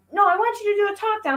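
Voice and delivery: put-on high, whiny child voice; whiny kid. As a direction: play the son begging for a talk down. Son's reply voiced by mother.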